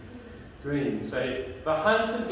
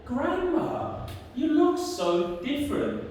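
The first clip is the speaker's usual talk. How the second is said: put-on high, wondering storybook girl voice; 10 semitones higher than usual. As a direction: pitch it well up, puzzled and childlike.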